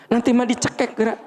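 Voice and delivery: fast high squeak with a laugh; mock scared voice. Repeats in squeaky voice. (suara melengking cepat sambil tertawa)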